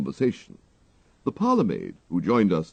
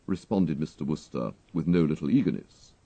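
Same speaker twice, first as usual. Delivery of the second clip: plain reading voice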